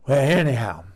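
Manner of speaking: ordinary talk, nothing marked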